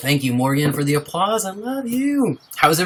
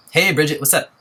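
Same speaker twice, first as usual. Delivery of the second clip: normal talking voice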